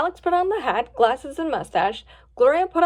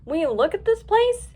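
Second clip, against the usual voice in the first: raised pitch, marveling at the sight; awed; 5 semitones higher than usual. A narrator's reading. Lion takes in the festival grounds, awed. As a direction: marvel at it, lifting the voice. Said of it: "gazing in awe".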